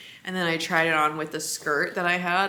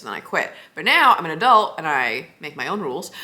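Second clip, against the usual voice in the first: normal talking voice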